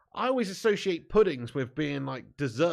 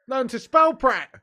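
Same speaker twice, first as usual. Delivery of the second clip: mock scolding shout; 9 semitones higher, 9 dB louder, slower than usual. Real, not acted. Mocking chat member's spelling.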